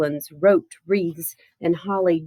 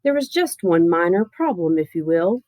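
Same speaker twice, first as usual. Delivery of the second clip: ordinary delivery, nothing marked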